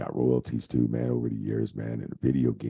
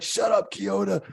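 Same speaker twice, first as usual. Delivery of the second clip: high, slow, put-on teasing voice; mocking wrestlers. Wrestlers teasing the referee, high slow mocking voice. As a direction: tease him in a squeaky made-up voice.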